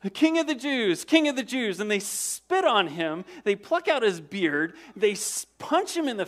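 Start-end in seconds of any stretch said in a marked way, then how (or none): 0.0-1.8 high, loud, sneering mockery; mocking. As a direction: jeer it like a soldier mocking a prisoner. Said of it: "they mock him".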